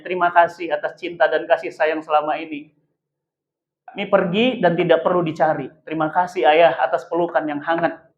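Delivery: soft, tender reading of a farewell letter; a lightly voiced, tender farewell note. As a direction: read it gently, like a letter from a child. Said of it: "itu bacanya indah benar".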